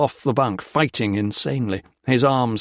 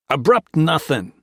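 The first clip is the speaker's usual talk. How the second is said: a short, spat-out retort, louder; 5 semitones higher, 4 dB louder than usual. A narrator's reading. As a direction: spit the words back in scorn. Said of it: "Max spat".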